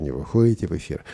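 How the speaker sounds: normal talking voice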